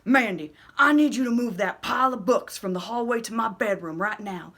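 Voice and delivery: a loud, commanding father's order; loud dad demanding it now; stern dad. Acted. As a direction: give the order like a strict dad. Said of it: "my dad turns to me and says".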